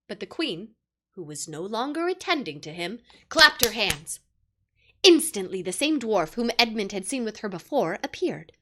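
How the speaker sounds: plain reading voice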